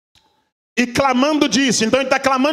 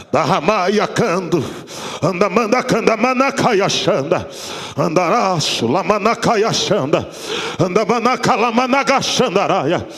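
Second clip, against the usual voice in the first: lower, rhythmic flowing syllables in prayer; speaking in tongues; 3 semitones lower than usual. Acted. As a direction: pour out tongues like a murmured chant.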